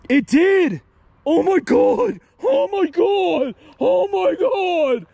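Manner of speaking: high, drawn-out amazed exclamations; stunned and thrilled, stretching the words; astonished. Real, not acted. Amazed the phone said a word.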